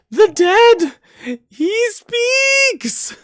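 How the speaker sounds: very high, loud theatrical shriek; shrieking alarm. Acted. Judge leaps up as the corpse talks, shrieking. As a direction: cry out in high-pitched horror, drawing out the last word.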